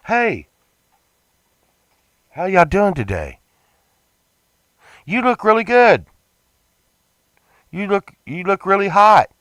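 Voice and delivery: high, cheery flirting with the clerk; flirty cheer. Retold or imitated. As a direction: lay on the friendly charm up high. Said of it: "I'm like".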